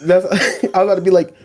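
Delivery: laughing mid-sentence; can barely get it out for laughing. Real, not acted. Laughing to his co-host about what he almost said.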